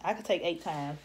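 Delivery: ordinary talk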